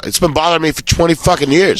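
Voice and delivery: high-pitched retold outburst; exasperated relief. Comic telling his costar what puzzled him since childhood, exasperated.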